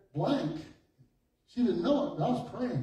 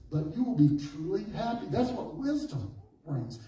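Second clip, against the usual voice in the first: ordinary talk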